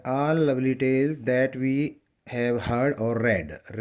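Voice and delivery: drawn-out reading of a poem line; slow poem recitation. Shown as a demonstration. Teacher reading a poem line to students, slow recitation. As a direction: read each word very slowly for the class.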